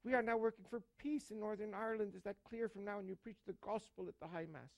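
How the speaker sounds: high, soft-spoken Irish archbishop; Irish accent. Acted. Archbishop reprimands a priest by phone, Irish accent. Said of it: "with his Irish accent".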